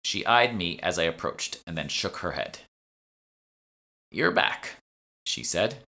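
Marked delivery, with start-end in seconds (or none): 4.1-4.8 put-on high, dry old woman's voice; a bored old shopkeeper, unimpressed; old shopwoman. Bored shopwoman greeting a returning customer, high and dry.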